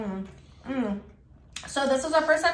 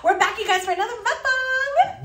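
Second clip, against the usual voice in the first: excited vlog intro; 10 semitones higher, 3 dB louder than usual. Acted.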